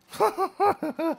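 high, loud burst of laughing; burst of laughter. Real, not acted. Gamer laughing at a bad opponent, loud high laugh. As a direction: crack up at the enemy.

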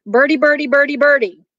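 high, rapid-fire babble; high mock jabbering; acted chattering. A narrator's reading. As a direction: rattle it off high, like imitating chatter. Mama mimics chattering talk.

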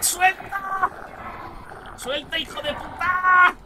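high, drawn-out cry to let go; panicked yell. Real, not acted. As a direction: stretch the last vowel in panic. Grabbed by game monster.

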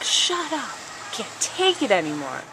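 mock fed-up, like she's had enough; fed-up snap. Friend quoting the teacher telling her to shut up, exasperated. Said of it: "She's like".

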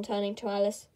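plain reading voice

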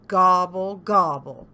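loud grumbled gobble; grumbling turkey. A narrator's reading. Turkey rebuffed by cow, grumbling gobble. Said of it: "grumbled Turkey".

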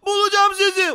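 loud, high promise to lost family; determined cry. Pretend. Vows to find missing family. (kararlı, yüksek sesle haykırış)

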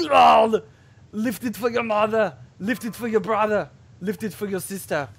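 loud, high mock lifting chant; mock gym motivator. Showing up grunters at the gym, mock motivator yell. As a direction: roar a grunt, then push each phrase. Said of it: "and I'm like".

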